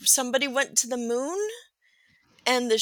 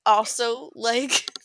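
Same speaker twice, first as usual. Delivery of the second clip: ordinary talk, nothing marked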